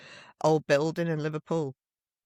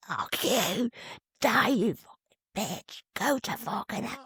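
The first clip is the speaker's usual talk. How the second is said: drawn-out mock rant, held back in level; retold angry shouting; 4 semitones higher, slower than usual. Imitating the man shouting at her in the street, angry. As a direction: imitate a ranting man without full volume. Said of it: "this guy's shouting at me".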